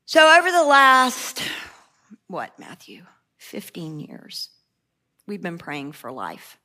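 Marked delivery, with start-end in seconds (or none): 2.3-6.6 unvoiced whisper to a helper; asking Matthew quietly, whispered; whispered aside. Real, not acted. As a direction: whisper off to the side.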